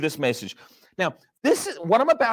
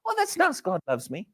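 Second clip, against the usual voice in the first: mock shrugging listener; 6 semitones higher, 4 dB quieter than usual. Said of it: "do you?".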